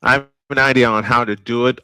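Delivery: ordinary talk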